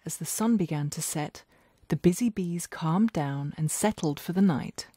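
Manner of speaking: plain reading voice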